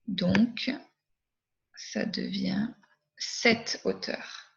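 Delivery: whispered correct form. Shown as a demonstration. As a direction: breathe the answer softly. (chuchoté doucement)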